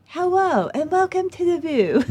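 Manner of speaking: high-pitched, lisping TV host impression; Barbara Walters. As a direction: raise the pitch into a hammy talk-show welcome. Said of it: "I tried to do Barbara Walters".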